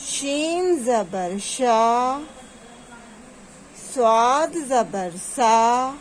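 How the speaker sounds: slow, even letter chant with level held endings; drawn-out repeat drill. Shown as a demonstration. Teacher asking pupils to repeat, drawn out. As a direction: lead pupils syllable by syllable, vowels stretched. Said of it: "دوبارہ سے repeat کیجیے".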